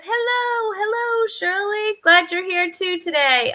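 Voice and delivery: high, playful voice for reading Shirley's greeting; put-on viewer voice. Host voicing a chat viewer's hello in a high playful voice. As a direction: pitch it up and play the viewer saying hello.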